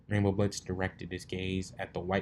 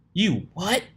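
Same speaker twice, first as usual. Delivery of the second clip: high, loud startled yelp; shocked; 11 semitones higher, 7 dB louder than usual. Acted. Pegasus mare hearing she was cloned, shocked yelp. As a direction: jolt up in disbelief.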